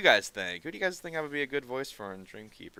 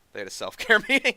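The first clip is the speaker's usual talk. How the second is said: laughing; 11 semitones higher, 10 dB louder, faster than usual. Real, not acted. Laughing while retelling the news.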